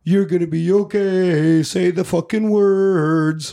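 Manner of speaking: slow, drawn-out reassurance; slow movie tough-guy impression; Harvey Keitel. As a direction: stretch the last words way out. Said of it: "you got Harvey Keitel like".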